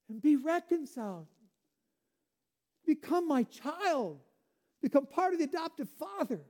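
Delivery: urgent calling. Acted. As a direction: call out like a father to a lost child. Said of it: "He's calling upon you".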